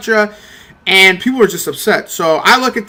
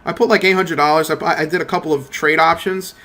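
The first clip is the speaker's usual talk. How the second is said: ordinary talk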